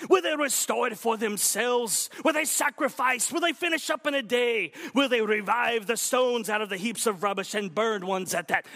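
high, hammed-up Welsh war cry; rally the army in a mock Welsh accent; Welsh-tinged battle cry. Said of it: "I was channeling Martin Lloyd-Jones".

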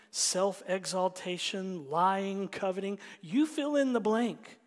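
loud, rising sermon climax; full-force preaching. Acted. Pastor proclaims Jesus' holiness, full-force preaching. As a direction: thunder it from the pulpit.

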